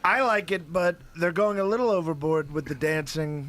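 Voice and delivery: Trump impression. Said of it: "What, Mister Trump".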